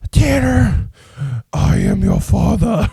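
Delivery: grandma's trach-voiced villain impression; Darth Vader. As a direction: play the dark lord through a leaky throat. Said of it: "played Darth Vader with Tanner".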